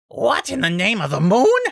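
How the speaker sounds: loud, high startled scream. A narrator's reading. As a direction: scream in shock. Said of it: "Rover screamed".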